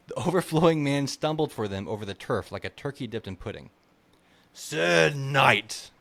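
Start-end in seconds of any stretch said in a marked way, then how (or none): 4.5-5.9 put-on loud, slurred drunk nobleman; drunken viscount cry. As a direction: call out like a tipsy lord. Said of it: "the Viscount cried".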